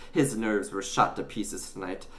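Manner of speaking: ordinary delivery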